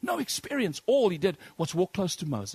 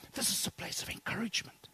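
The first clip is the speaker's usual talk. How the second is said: whisper; 4 semitones higher, 9 dB quieter than usual. Acted. Preacher drops voice to reassure.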